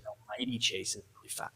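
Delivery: Mighty pushed out firmly; correcting dad by leaning on "Mighty"; stress on Mighty. Retold or imitated. Daughter correcting her father, stressing "Mighty". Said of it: "She said".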